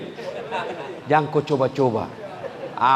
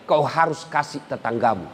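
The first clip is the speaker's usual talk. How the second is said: usual speaking voice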